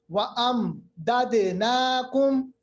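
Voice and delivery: وَأَمْدَدْنَاكُمْ slowly, with a distinct first mim; clearly sounded mim. Shown as a demonstration. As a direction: sound أَمْ, then move into the dal. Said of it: "میم کی آواز نکالی". (میم ظاہر کر کے ٹھہری تلاوت)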